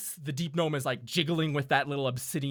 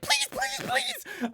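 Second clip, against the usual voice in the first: screeched pleas, far higher, in panic; desperate gnome begging; much slower than usual. Acted. Deep gnome begging the fiend for mercy, screeching. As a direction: beg for your life in a shrill squeal. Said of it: "the deep gnome is like".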